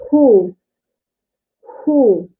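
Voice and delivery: "who" as a rounded breathy hu; Korean hu syllable. Shown as a demonstration. Teacher showing the h-plus-oo vowel to students. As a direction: voice "who" cleanly, lips rounded. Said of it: "then you will read it like who".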